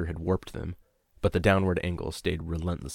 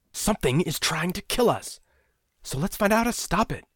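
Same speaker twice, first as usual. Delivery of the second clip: furious; 12 semitones higher than usual. A narrator's reading.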